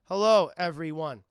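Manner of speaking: slow, loud formal announcement; mock press statement. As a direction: address the press grandly. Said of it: "and he's like".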